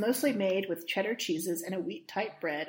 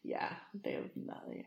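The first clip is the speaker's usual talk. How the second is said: breathy whispered aside; 11 dB quieter than usual. Real, not acted. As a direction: whisper it under your breath. Aside muttered off mic.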